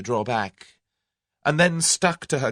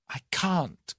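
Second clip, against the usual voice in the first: gasped; 8 semitones higher than usual. Acted. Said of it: "gasped Tuppence".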